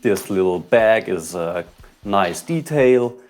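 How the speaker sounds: ordinary talk, nothing marked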